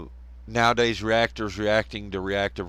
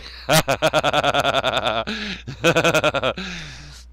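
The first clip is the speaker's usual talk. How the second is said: long rolling cackle in bursts; hearty cackle; 4 semitones higher, 4 dB louder than usual. Real, not acted. Host amused by disruptive technology joke, long cackle. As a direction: laugh hard, pitched up. Laughing at his own joke.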